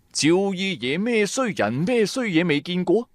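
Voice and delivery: plain reading voice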